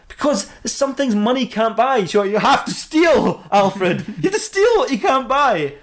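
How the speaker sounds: high, drawn-out mock protest; hammed-up righteous whining; indignant justification. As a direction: plead the case with stretched key words. Batman justifies pirating to Alfred.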